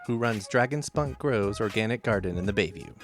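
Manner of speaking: ordinary delivery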